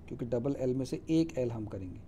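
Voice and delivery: ordinary delivery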